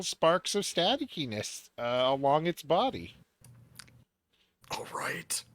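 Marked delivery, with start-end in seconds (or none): 4.7-5.4 whisper. Real, not acted. Unvoiced whisper aside.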